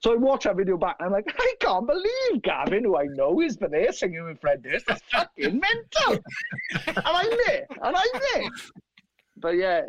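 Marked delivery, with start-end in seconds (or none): 1.4-6.2 high-pitched, incredulous gushing; mock-astonished excitement, voice leaping up; amazed fanboy squeal. Acting himself amazed at video. 6.9-8.5 very high, giddy disbelief; amazed squeal. Retold or imitated. Amazed at seeing himself on video.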